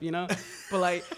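ordinary delivery

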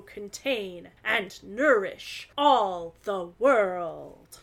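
slow, booming stage declamation; dramatic Shakespeare recital. Acted. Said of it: "Striking a dramatic pose".

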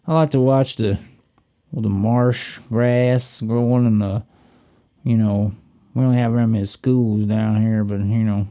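halting, folksy Southern accent put on as a joke. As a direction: play the unhurried Southern rustic they imagine. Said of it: "that's what they think you sound like".

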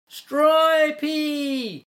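high, long-held call; yelling a name. A narrator's reading. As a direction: holler down into the tunnel. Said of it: "they yelled".

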